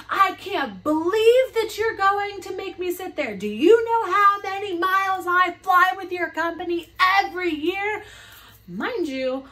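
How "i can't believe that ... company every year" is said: high, loud and outraged, dragging out how dare you; furious mock shout. Angry passenger screaming at the supervisors over a child. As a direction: shriek at the staff like an entitled passenger. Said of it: "entitled passenger ranting".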